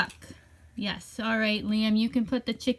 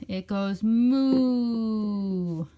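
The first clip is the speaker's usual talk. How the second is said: drawn-out moo; much slower than usual. Pretend. Teaching kids animal sounds.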